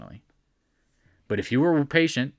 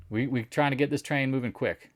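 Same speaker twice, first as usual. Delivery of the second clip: ordinary talk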